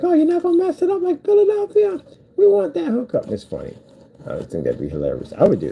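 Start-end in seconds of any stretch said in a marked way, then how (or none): 0.0-3.3 high-pitched, louder crowd voice; mock eager crowd. Rival cities' fans begging for the same mistake, mock high-pitched pleading. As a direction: put on a high, excited fan voice. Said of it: "Other places like".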